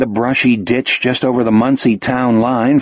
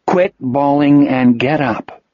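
ordinary talk

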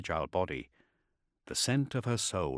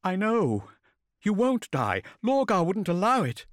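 high, earnest woman's voice; pleading reassurance, pitched up; Cyrene, insistent; 10 semitones higher, 4 dB louder than usual. A narrator's reading.

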